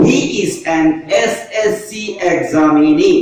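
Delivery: "he is an SSC examinee" read very slowly and clearly; slow model sentence. Shown as a demonstration. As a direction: model the sentence word by word for the class.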